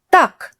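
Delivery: put-on Так snapped high, a teacher correcting a child; sharp teacher tak. Shown as a demonstration. Teacher showing how a sharp Так scolds a child. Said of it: "Say так sharply and it sounds like a teacher".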